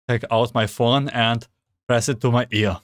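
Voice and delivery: ordinary talk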